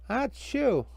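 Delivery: high acted sneeze. Karen sneezes again.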